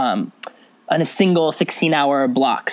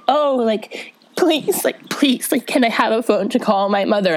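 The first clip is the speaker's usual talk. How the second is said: pleading; 6 semitones higher than usual. Retold or imitated. Jenna begging in the show.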